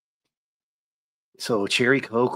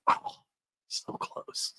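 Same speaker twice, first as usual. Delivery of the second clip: whispered regret; 9 dB quieter, much slower than usual. Pretend. Mock disappointment near miss.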